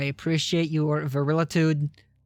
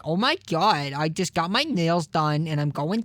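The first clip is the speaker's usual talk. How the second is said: put-on New York accent. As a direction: stretch the vowels like a New York woman. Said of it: "You mean like that sort of a thing?".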